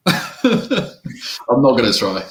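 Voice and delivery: laughs through the refusal, slow; laughing refusal. Real, not acted. Turning down the co-host's French accent dare, laughing. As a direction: refuse while still laughing.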